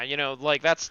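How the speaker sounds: ordinary talk, nothing marked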